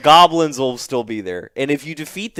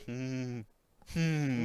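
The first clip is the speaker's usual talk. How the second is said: mock pondering; 3 semitones lower, 15 dB quieter than usual. Imagined Goblin Slayer pondering.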